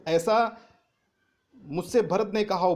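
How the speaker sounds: normal talking voice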